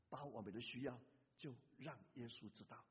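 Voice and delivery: quiet, earnest aside; soft repeat. Acted. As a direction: ease into a gentle reminder. Preacher repeats softly.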